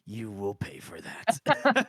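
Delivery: low, slow mock menace; villain voice. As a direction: growl a hammy villain threat. Mock threat after insult.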